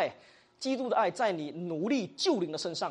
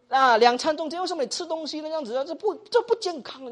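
high-pitched, loud nagging objection; mock reproach; 8 semitones higher, 7 dB louder than usual. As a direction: criticise their eating habits as a bad example. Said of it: "会给人这种，给人一种反感". (高声数落)

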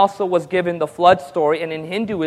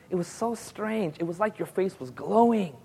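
hushed awe; 11 dB quieter than usual. Retold or imitated. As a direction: confide it like a secret. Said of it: "And he says".

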